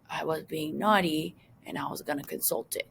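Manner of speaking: breathy hushed whisper; naughty whisper. Pretend. Teacher teasingly whispers about consulting the forbidden website. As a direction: whisper it like confessing a naughty idea.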